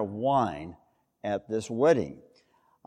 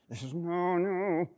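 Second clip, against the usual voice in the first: hushed, higher-pitched grumbling protest; exasperated refusal; 3 semitones higher, 7 dB quieter, slower than usual. Acted. Jesus brushing off his mother, mock exasperation. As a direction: softly whine a refusal. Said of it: "you could take it this way".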